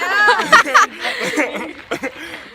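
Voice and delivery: loud embarrassed laugh; burst of laughter. Real, not acted. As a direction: crack up laughing. Laughing at own Spanish.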